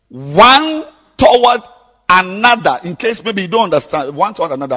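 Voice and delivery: very high, loud shouted scripture reading; high-pitched pulpit shout; preaching at full force. Acted. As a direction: belt out the verse, stretching the key words.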